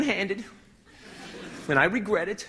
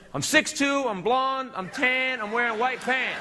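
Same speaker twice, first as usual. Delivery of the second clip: higher-pitched anxious self-description; mock prison panic; 5 semitones higher, slower than usual. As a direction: list it with dawning alarm, pitched up. Arrives in prison, repeats opening line.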